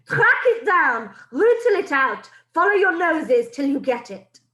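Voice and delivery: loud, high, slow hunting orders; a witch screaming at her coven to hunt. Acted. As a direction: drive the pack on, screaming. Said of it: "screams the Grand High Witch".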